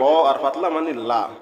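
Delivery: ordinary delivery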